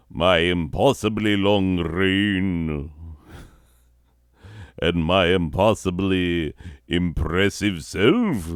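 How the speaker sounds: grand, drawn-out royal proclamation; slow, swelling speech of a vain ruler; pompous sovereign. Pretend. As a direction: address your subjects as their self-adoring monarch.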